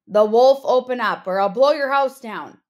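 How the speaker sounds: loud demanding wolf voice; wolf's threat. Acted. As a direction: bang out the threat through the door. Wolf threatens pig at door.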